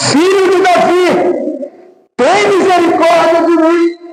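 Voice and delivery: high-pitched pleading cry. Retold or imitated. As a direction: call out for mercy, pitched far up. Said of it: "cada vez gritava mais".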